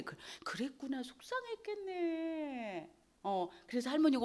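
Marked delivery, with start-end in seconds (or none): 0.4-2.9 gentle comforting. Retold or imitated. Grandma comforting child.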